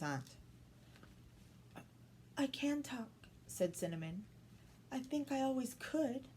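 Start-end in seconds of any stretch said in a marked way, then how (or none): 2.4-6.3 put-on higher, softer little girl voice; soft young girl. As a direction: speak gently as a shy girl. Girl speaks her first words.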